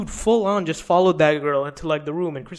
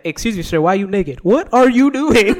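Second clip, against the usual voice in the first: startled host; 5 semitones higher, 9 dB louder than usual. Retold or imitated. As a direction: caught off guard and cracking up. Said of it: "He's like".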